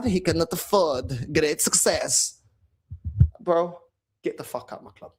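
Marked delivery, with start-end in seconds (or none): 1.3-2.4 mock catchphrase voice. Catchphrase in put-on voice. 4.2-5.1 unvoiced, hissed under the breath; whispered dismissal. Real, not acted. Fan whispering at the manager to leave, hushed.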